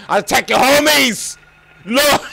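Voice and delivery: loud, high yell; high-pitched threat yelled in a fight; real outburst. Real, not acted. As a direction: shout it at the game. Startled outburst at the game.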